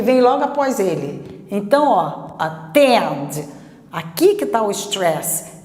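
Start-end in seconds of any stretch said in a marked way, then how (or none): 2.4-3.5 "attend" com A fraquinho e "TEND" forte; segunda sílaba tônica. Shown as a demonstration. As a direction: apoie toda a força em "tend" de "attend". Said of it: "a sílaba forte é a segunda". ("attend" grave, com acento na segunda sílaba)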